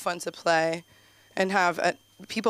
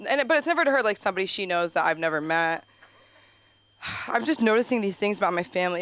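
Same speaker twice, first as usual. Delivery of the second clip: ordinary delivery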